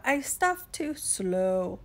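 drawn-out, sluggish turtle delivery; slow turtle drawl. Acted. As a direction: take your time, stretching out "slow".

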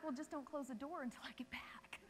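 whispered retort to yourself; private thought. Retold or imitated. Passenger silently answering the gate agent, whispered aside. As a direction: whisper it as an unspoken objection. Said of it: "in the back of my mind".

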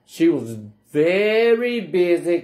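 VERY held long with a singsong lift; stretched VERY. Acted. Reader gets children to say the refrain, stretching VERY. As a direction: draw out "very" to cue a chorus.

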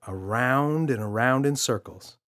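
ordinary delivery

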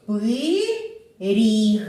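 drawn out syllable by syllable, «пи-ріг»; по складах. Shown as a demonstration. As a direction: stretch each syllable of «Пиріг» for a class to join in. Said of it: "Давайте промовимо разом". (протяжно, по складах)

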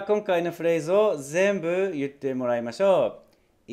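ordinary delivery

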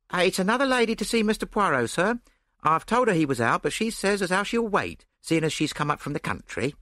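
lightly voiced landlady; landlady's voice. A narrator's reading. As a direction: rattle it off like a fussy landlady. Landlady voiced, announcing visitor.

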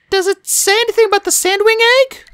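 put-on high girlish character voice with mild nerves; understated nervous high voice; nervous question. A narrator's reading. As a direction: ask it high, a touch nervously. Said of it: "she asked nervously".

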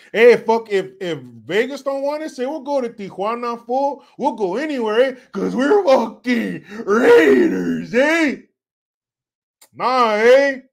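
loud, sing-song Chicano homeboy; Chicano homeboy accent. Acted. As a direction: boast in a Chicano accent, rising "eh?" tags. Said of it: "I can do that accent so well".

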